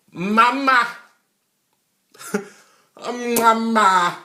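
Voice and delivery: high, loud mock wail of Mama; hammed-up whiny call for mama; grown man crying for mama. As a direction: grown man hollering for his mother. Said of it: "full-grown men that are still".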